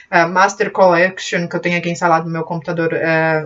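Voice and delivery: ordinary talk, nothing marked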